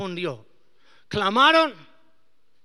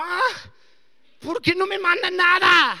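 higher, louder anxious complaint; anxious whine; 10 semitones higher, 10 dB louder than usual. Retold or imitated. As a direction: whine about being ignored. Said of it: "me puse como". (queja ansiosa, más aguda)

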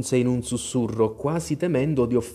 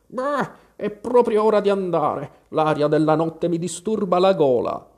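put-on high, loud fussy old bird; old magpie; 6 semitones higher, 4 dB louder than usual. A narrator's reading. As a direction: grumble excuses as an old magpie. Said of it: "Una vecchia gazza". (vecchia gazza, voce acuta e forte)

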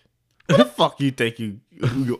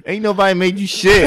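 high, raised-voice comeback; mock angry retort; 7 semitones higher than usual. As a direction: fire back in a higher voice, playing fed up. Acted quarrel, snapping back.

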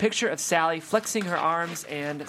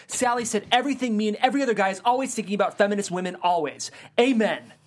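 fired-up caller; 4 semitones higher than usual. Acted. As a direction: rush it like an eager fan. Excited male caller.

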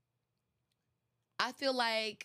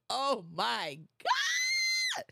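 joyful shout; 4 semitones higher, much slower than usual. Pretend. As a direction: stretch it out slow in an excited cry.

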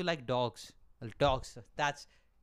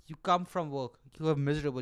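ordinary talk

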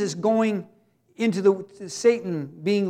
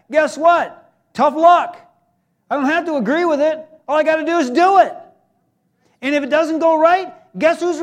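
forceful preaching; 6 semitones higher, 6 dB louder than usual. Real, not acted. Preacher driving the point home.

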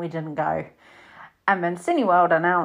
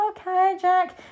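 wry put-down; 12 semitones higher, slower than usual. Retold or imitated. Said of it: "you know".

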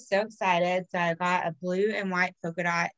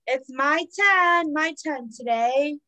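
slow playful drawl; 5 semitones higher, slower than usual. Acted. Drawn-out playful repetition.

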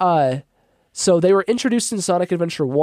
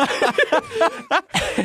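high squealing laugh. Real, not acted. Laughs at throwing eggs at wall.

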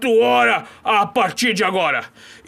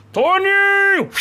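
high, loud call across the yard; loud call for a servant; 10 semitones higher, 5 dB louder, much slower than usual. Acted. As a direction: summon him from far away. Calling the servant from afar.

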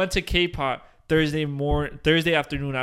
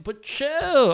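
chill; 9 semitones higher, 4 dB louder than usual. Real, not acted. Telling admirers to back off.

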